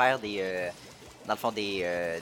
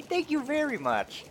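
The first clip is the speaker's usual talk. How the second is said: delighted thanks; slower than usual. Real, not acted. Thanks the message writer.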